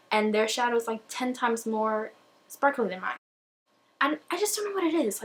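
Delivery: ordinary delivery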